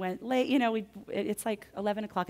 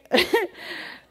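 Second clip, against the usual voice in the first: a breathy, much higher laugh; airy high laugh; 14 semitones higher, 9 dB louder than usual. Real, not acted. To the audience after the story of crying on the pavement, an airy high laugh.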